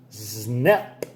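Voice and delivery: ordinary delivery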